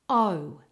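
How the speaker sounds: O said as a glide from oh into oo; two-part vowel. Shown as a demonstration. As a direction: round the O off toward a W. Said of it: "Make sure you get the O and the U".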